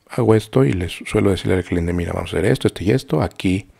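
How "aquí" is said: usual speaking voice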